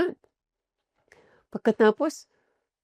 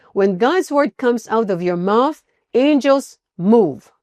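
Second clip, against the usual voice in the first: slow emphatic preaching; 4 dB louder, slower than usual. Real, not acted.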